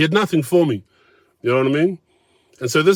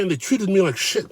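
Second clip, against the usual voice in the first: higher self-pitying whine; higher whining quote of the diner; mock whiny quote; 6 semitones higher than usual. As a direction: whine the complaint like him. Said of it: "Those were the words".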